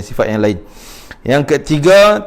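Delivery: ordinary delivery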